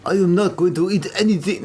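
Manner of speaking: hammed-up mock French accent; teasing caricature of a French accent; exaggerated French accent. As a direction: lay the French accent on thick. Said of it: "No, it's more like".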